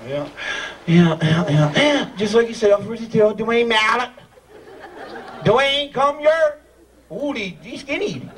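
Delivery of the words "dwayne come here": calling out. Real, not acted. Calling a volunteer up.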